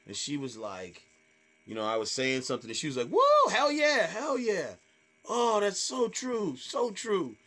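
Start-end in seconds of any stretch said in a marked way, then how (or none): 3.1-7.3 high mock whoop, easing into gushing agreement; over-encouraging heckler. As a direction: cheer along far too supportively. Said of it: "very encouraging, but over encouragingly".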